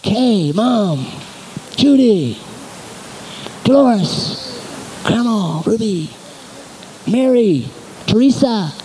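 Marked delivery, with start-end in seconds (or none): none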